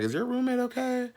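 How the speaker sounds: concerned friend asking; high worried friend. Retold or imitated. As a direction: friends asking about the roommate. Friends quoted.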